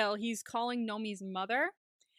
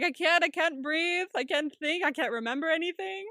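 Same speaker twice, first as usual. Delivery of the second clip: raised, overwrought complaining; mock panic; 6 semitones higher, 3 dB louder than usual. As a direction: fret theatrically, as if falling apart. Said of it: "he's freaking out".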